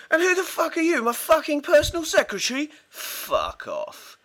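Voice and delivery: very high, strained mock outrage; mock outraged manager. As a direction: squeal it out in scornful imitation. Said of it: "I said".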